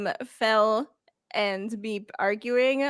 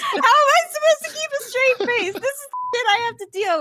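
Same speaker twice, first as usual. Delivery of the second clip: laughing; 13 semitones higher, 7 dB louder than usual. Real, not acted. GM laughing in disbelief.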